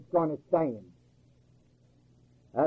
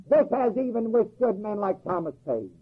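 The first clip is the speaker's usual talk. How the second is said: ordinary delivery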